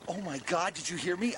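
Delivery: ordinary talk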